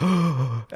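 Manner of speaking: imitated scandalised intake of breath; mock shocked gasp. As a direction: gasp like an appalled mother. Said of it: "my mom was like".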